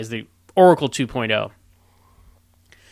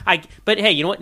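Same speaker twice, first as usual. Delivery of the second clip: ordinary delivery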